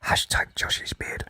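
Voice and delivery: whispered aside, slow and hushed; stage whisper. Acted. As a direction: lean in and whisper it conspiratorially. Whispered aside about Josh's beard.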